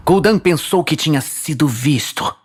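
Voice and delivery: usual speaking voice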